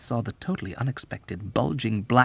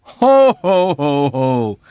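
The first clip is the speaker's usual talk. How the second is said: high, loud ho ho ho; booming mock laughter; hearty laugh; 4 semitones higher, 10 dB louder, much slower than usual. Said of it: "Arfie continued".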